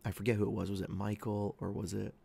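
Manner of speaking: ordinary talk, nothing marked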